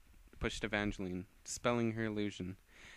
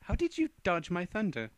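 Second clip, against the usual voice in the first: higher and louder surprise; astonished demand; 8 semitones higher, 5 dB louder than usual. Acted. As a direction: blurt it out shocked. Startled character question.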